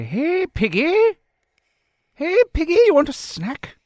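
very high, loud singsong call; coaxing an animal. Acted. Prisoner tempts pig with a snack, high-pitched coaxing. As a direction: call a piggy as to a pet.

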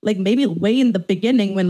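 ordinary talk

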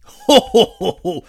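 loud, high mocking "ho ho"; booming villain chuckle; hearty pirate laugh. A narrator's reading. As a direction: laugh scornfully as a pirate captain. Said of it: "laughed heartily".

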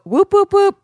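high, loud celebratory whoops; whooping cheer. Acted. Reads out a listener's cheer.

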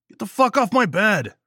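yelling, furious and loud; angry shout. Acted. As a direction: shout him off the bed. Daniels yelling from his bed.

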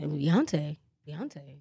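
low, soft [name] voice; [name] impression. Asked what [name] sounds like, low and soft impression. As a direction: drop down low and hush it like [name]. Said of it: "What's [name] sound like?".

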